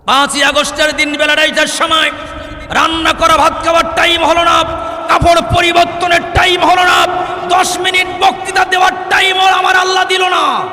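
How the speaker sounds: high, shouted rhythmic build of parallel lines; full-force preaching. Real, not acted. Preacher recounting a ruler's sudden fall, full-force preaching. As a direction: hammer out each line to the crowd at full voice.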